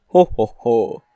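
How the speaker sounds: mock hearty Christmas chuckle; Santa Claus. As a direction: belt out a festive belly laugh. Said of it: "We all know Santa Claus".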